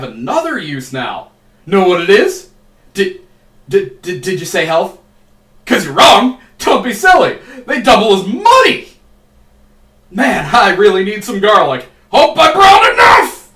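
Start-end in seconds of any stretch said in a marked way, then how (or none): 1.6-8.9 loud, teasing game-show gotcha; mocking quiz host. Mock quiz, mocking viewer answer. 10.1-13.5 high, loud, slow comic shout; loud joking yell. Acted. Joking about needing garlic.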